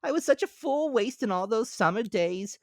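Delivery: high, loud cartoon grasshopper; bright piping insect voice. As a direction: squeak it out as the carefree grasshopper. Grasshopper character voice.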